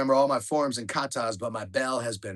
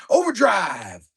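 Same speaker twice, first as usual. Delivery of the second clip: high, loud triumphant yell; a sensei's corny rallying shout; 8 semitones higher, 10 dB louder, much slower than usual. Retold or imitated. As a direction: bellow it like a battle cry. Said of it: "and he yells out".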